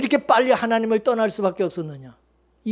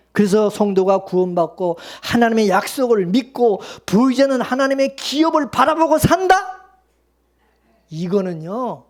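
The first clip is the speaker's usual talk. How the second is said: ordinary talk, nothing marked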